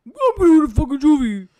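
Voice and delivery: loud, high mock declaration; mocking Archie in court, loud and hammed-up; mock shout. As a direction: bellow it like a dumb hero.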